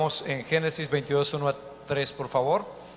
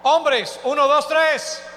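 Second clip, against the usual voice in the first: loud, high count-in for the crowd; high-pitched, full-voiced crowd call; rallying shout; 9 semitones higher, 7 dB louder, slower than usual. Real, not acted. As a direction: shout the cue like a rally leader. Cueing women and men to shout.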